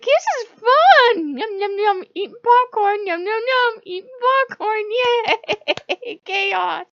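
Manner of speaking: very high, loud squealing cartoon glee; gleeful impression. Onlooker cheering on a shouting match, hammed-up squeaky delight. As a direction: chant it like a giddy mischief-maker with popcorn.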